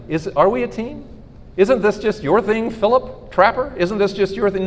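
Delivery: high, questioning wife voice; doubting wife. Retold or imitated. Wife to husband doubting the team, questioning. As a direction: press him, doubtful.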